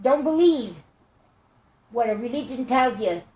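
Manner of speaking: ordinary delivery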